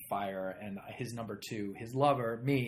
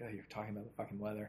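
retold inner voice; 2 semitones lower, 9 dB quieter, much faster than usual. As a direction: say it fast and soft, as if thinking it. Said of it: "in my head, I'm like".

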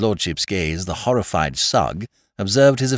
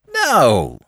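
put-on drunken refusal; 5 semitones higher, 5 dB louder than usual. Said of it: "said Lord Peter".

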